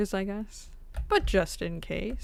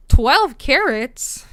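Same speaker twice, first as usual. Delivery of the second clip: louder and slower, amazed at the price; surprised; 5 semitones higher, 13 dB louder, much slower than usual. Real, not acted. Streamer reacting to the gem price, surprised and slow. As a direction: let the words stretch out in disbelief.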